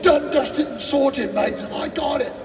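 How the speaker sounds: cocky Brit brushing it off; lightly done London bloke; British accent. Retold or imitated. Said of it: "If he's British, I'll give you that".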